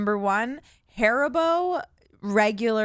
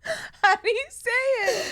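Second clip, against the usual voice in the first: asking through laughter; giggling question; laughing; slower than usual. Real, not acted. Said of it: "laughing, asking pronunciation".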